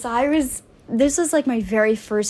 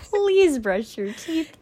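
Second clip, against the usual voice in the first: mock PSA; 5 semitones higher, much slower than usual. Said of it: "P S A to all boys".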